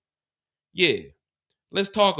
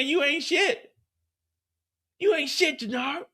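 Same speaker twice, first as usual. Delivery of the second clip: imitating Shanti; 10 semitones higher than usual. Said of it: "she had the nerve to say".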